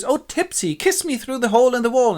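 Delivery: very high lovelorn stage plea; hammy Pyramus. Acted. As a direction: plead like an overacting lover. Pyramus begs a kiss.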